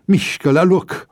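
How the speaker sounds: high, loud breathless bark; panting roar. A narrator's reading. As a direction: roar it while catching breath. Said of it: "răcni gâfâind".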